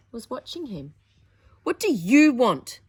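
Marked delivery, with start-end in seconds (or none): 1.6-2.8 loud angry snap at his sister; snapped. Acted. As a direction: bark it at the intruder. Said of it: "he snapped".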